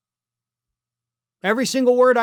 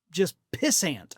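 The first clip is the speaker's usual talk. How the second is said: usual speaking voice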